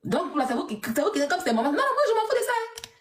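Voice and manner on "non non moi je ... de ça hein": high-pitched scoffing dismissal; shrill mock dismissal. Real, not acted. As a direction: brush it off in a high squeal. Scornful dismissal.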